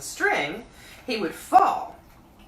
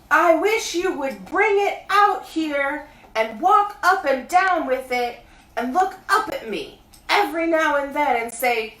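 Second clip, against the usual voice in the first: high, loud bear calling down; Pooh voice; 10 semitones higher, 8 dB louder than usual. A narrator's reading. Pooh calling down to Christopher Robin, high bear voice. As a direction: call up from the honey tree like a bear. Pooh explains his plan.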